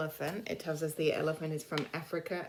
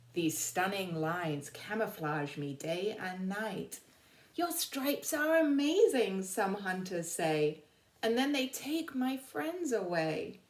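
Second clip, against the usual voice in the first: slow, lilting read-aloud, higher on the pleas; reading poem to children; 3 semitones higher, slower than usual. Acted. Teacher reading the zebra's poem to the class, slow and lilting. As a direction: read the zebra's rhyme like a storybook.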